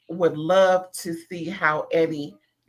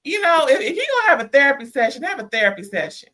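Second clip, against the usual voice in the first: worked-up rant; 6 semitones higher, 4 dB louder, much faster than usual. Real, not acted. Ranting about the therapy session.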